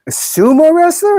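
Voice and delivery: high-pitched, offended disbelief; scandalized squeak of a question; incredulous echo. Real, not acted. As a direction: shoot the question way up in shock. Repeats chat insult in disbelief.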